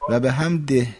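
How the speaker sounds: حمده said "hamdeh" with an everyday h; light audible h closing حمده; natural final h. Shown as a demonstration. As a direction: let the last ه of حمده sound, unforced. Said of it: "این حرف آخرش صداش بیاد".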